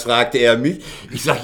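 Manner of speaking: ordinary talk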